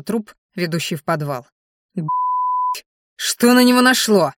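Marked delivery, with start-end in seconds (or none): 1.9-4.3 loud, high furious outburst; angry. Acted. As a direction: explode at the sudden betrayal. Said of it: "разозлился Бурый".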